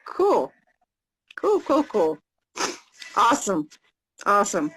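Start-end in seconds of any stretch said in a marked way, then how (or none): none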